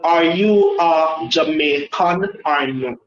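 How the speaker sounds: usual speaking voice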